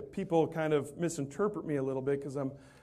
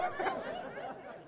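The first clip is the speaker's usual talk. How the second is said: unvoiced laugh of delight; soft real laughter; breathy laugh; 9 dB quieter than usual. Real, not acted. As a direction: let out a silent laugh. After punchline.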